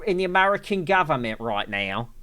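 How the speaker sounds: exaggerated British impression, stretching the opening words; Russell Brand. Streamer showing off a supposedly perfect impression, mock British accent. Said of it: "I was doing a perfect impression of him".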